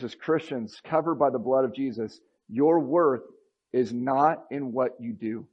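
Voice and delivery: usual speaking voice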